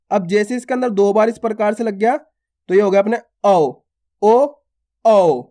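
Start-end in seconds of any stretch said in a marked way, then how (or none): 4.2-5.4 ओ said plain, then औ stressed louder; ओ and औ, the second pushed harder. Shown as a demonstration. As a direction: contrast ओ with a forceful औ. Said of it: "थोड़ा जोर लग रहा है".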